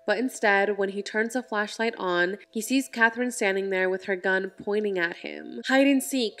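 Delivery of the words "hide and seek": higher, restrained call; lightly acted urgent command; mild mock scream. A narrator's reading. Narrator as Catherine signalling Emily beside her, mild mock scream. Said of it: "she screams to Emily".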